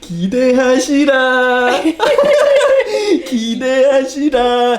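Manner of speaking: high, loud playful build-up; teasing hype. Shown as a demonstration. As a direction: hype up the surprise, laughing. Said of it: "It means, like".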